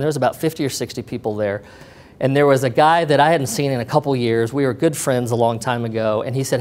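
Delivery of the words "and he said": ordinary delivery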